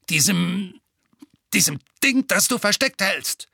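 high, worked-up insistence; annoyed and halting; irritated bully. A narrator's reading. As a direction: grope for the word, pitched well up. Bully stammering in narrated quarrel.

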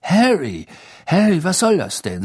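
loud, high, upset scolding; worked up and exclaiming; agitated. A narrator's reading. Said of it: "sagte Fudge aufgebracht".

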